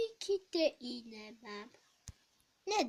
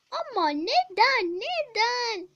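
whining plea; 7 semitones higher, 9 dB louder, slower than usual. Acted. Child pleading with mother.

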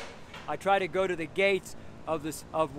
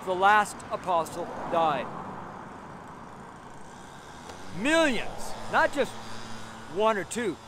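forceful, raised sermon voice; preaching; 4 semitones higher than usual. Real, not acted. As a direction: drive each phrase home to the crowd.

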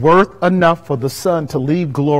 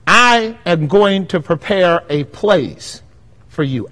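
normal talking voice

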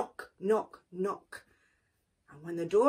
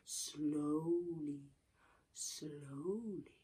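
soft drawn-out slowly; 5 semitones lower, 9 dB quieter, much slower than usual. A narrator's reading. Narrator slow and soft.

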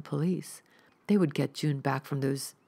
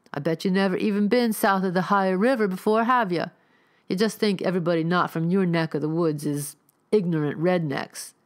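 berating; 4 semitones higher, 5 dB louder than usual. Acted. Rifleman berates Neil.

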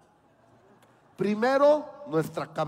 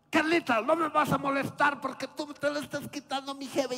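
put-on high whiny little boy voice; mock kid complaint; 8 semitones higher than usual. Little boy telling Carlita off, high and whiny. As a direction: pitch it up like a pouty kid. Child Geri scolding Carlita.

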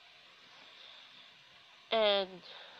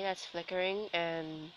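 normal talking voice

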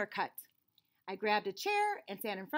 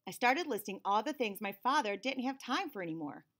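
plain reading voice

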